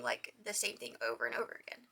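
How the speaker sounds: ordinary talk, nothing marked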